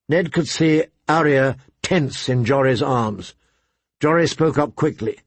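ordinary delivery, nothing marked

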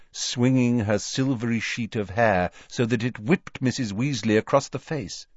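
plain reading voice

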